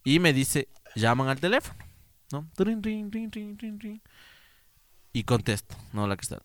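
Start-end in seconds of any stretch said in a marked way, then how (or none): 2.5-4.0 high sing-song trin trin of a ringing phone; phone ring imitation. Narrator mimics the ringing telephone, sing-song.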